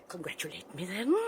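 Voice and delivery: whisper. Acted. Taunting the lovers.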